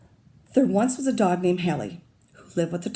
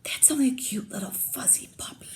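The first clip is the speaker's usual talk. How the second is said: put-on high whispered baby talk; cooing whisper; 5 semitones higher, 4 dB quieter than usual. Burglar cooing over the dog, whispering. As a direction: coo it under the breath. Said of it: "whispered the other burglar".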